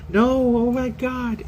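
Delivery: very high, slow squeal of protest; high, slow excited no; gushing reassurance. Retold or imitated. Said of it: "I was like".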